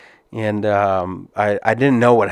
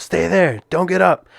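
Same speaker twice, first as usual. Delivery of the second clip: higher, urgent command to stay down; ref yelling; 7 semitones higher than usual. Retold or imitated. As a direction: firmly order him not to move. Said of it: "kinda yelling at me".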